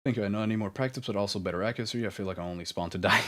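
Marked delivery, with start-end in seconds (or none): none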